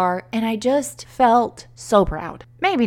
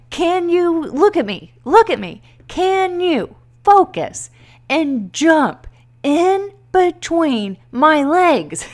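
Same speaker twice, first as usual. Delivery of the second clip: slow, drawn-out, higher coaxing voice; coaxing husband; 8 semitones higher, 4 dB louder, slower than usual. Retold or imitated. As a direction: coax her slowly like a patient husband. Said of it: "he's like".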